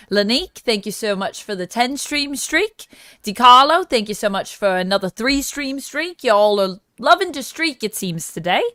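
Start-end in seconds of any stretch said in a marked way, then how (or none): none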